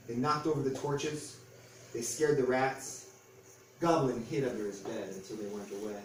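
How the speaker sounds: plain reading voice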